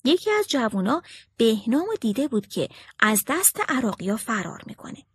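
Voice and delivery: usual speaking voice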